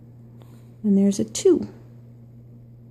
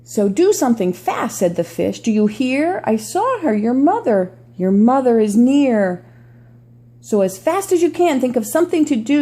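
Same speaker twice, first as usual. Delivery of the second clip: storybook read-aloud voice; reading to children; 3 dB louder than usual. Acted. Reads picture book aloud.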